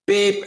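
high electronic beep sound; imitated timer beep. Instructor imitating the range timer to the host, high beep. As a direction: mimic the shot timer going off.

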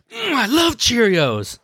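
high, slow mock British; imitating British accent. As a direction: ham up a British accent on the pun. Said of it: "the guy has, like, a British accent".

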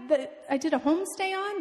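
high, animated disbelief; retold astonishment, pitch held high; amazed explanation. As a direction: relive being stunned by the coincidence. Acts out her astonished explanation.

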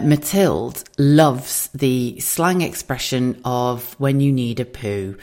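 ordinary delivery

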